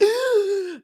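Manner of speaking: sharp high gasp of fright; retold gasp. Retelling the near crash to viewers, a sharp high gasp. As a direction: suck in air like a car is about to hit. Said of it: "I was like".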